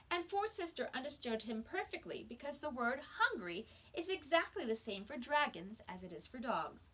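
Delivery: ordinary delivery, nothing marked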